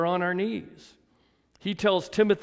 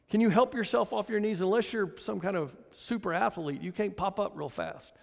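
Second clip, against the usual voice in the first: ordinary talk, nothing marked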